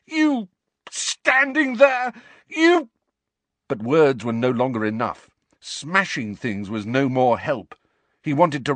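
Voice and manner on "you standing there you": choking roar. A narrator's reading. Harry roaring, words failing.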